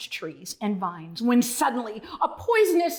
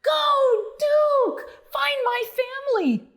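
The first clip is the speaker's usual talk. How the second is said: much higher, louder cheer; excited cheering; 13 semitones higher, 4 dB louder than usual. Acted. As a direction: cheer him on at the top of your voice.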